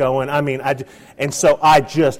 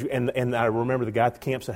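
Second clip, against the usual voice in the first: ordinary delivery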